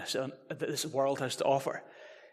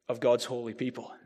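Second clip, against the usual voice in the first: usual speaking voice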